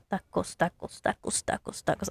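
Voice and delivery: "Tacos" with a short front a and a crisp s; practised pronunciation. Real, not acted. Designer testing how to say the word tacos, practised pronunciation. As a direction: say "tacos" as TAH-kos, short vowels, s not z. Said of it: "talking with an accent as far as".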